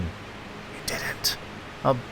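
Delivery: soft, voiceless whisper; whispered contradiction. Acted. Inner voice answering Jack's claim, whispered.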